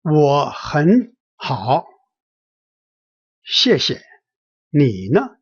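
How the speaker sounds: slow, word-by-word model reading; demonstration reading. Shown as a demonstration. Teacher models the Chinese reply for learners, slow demonstration reading. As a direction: say each syllable slowly and separately. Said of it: "Let me read it again".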